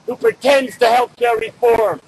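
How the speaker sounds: normal talking voice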